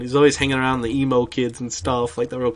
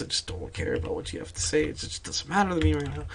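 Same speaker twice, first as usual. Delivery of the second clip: dismissive murmur; 4 semitones higher, 6 dB quieter than usual. Retold or imitated. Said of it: "I was just like".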